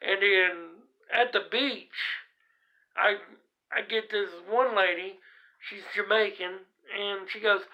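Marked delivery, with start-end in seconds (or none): none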